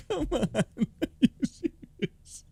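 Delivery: can't stop laughing while asking; incredulous laughing question; laughing disbelief. Real, not acted. Laughing at the clip.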